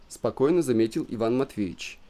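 usual speaking voice

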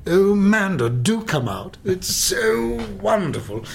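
plummy Englishman. Acted. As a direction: gush it like a dyed-in-the-wool Englishman. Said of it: "dyed-in-the-wool Englishman".

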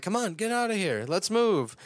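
high, fast mock urgency; urgent hurry. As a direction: hurry everyone out. Sandy urges escape in recap.

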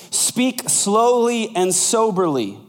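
slow. Shown as a demonstration. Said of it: "That doesn't mean to talk slow".

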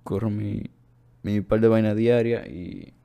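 ordinary delivery

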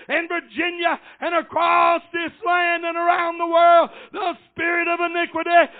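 high, loud sustained pulpit shout; full-force preaching. Real, not acted. Preacher warning church that iniquity is working, full-force preaching. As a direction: cry out the warning in driving phrases.

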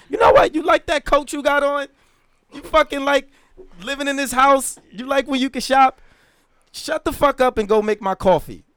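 loud, high, furious husband tirade; acted angry outburst. TV husband turns on his arguing wife, angry outburst.

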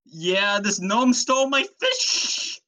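high, drawn-out cartoon complaint; high-pitched hammed-up outrage; Fisherman Joe. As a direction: whine it high and long as the wronged victim. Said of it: "Fisherman Joe".

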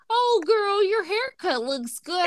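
high, teasing imitation of fans; mock compliment. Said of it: "some people were like".

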